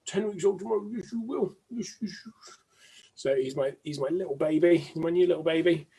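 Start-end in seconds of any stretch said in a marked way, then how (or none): 0.1-2.6 puppy voice. Real, not acted. Owner to puppy turning ten weeks, cooing in puppy voice. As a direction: coo fondly right into the puppy's fur.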